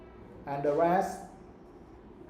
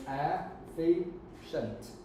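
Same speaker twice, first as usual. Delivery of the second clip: "Efficient" stretched slowly into its three syllables; 4 dB quieter, much slower than usual. Shown as a demonstration. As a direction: draw out "Efficient" so each syllable is clear. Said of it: "How many syllables does it have?".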